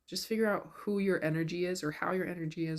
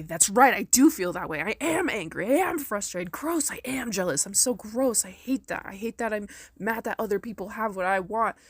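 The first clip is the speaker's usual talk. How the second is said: louder and higher, bold declarations; owning it out loud; 4 semitones higher, 6 dB louder than usual. Retold or imitated. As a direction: proudly own each feeling, full voice. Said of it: "You can say".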